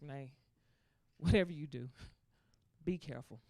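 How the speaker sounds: low, hushed cautioning; soft, deep motherly warning; mother's warning. Acted. Mom warning daughter on the phone, low and hushed. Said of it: "So I called my mom".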